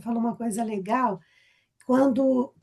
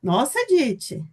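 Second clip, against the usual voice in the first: teasing friend; 5 semitones higher than usual. Retold or imitated. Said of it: "a Cícera nunca falaria pra mim".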